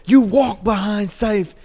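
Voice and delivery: slow, loud, high mock yell; imitated screaming. Veteran putting newcomer in his place, imitated scream. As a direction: imitate a boss putting someone in their place. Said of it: "he's, like, screaming on me".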